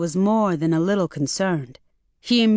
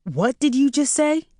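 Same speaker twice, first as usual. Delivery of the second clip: shocked; 7 semitones higher than usual. A narrator's reading. Jay shocked.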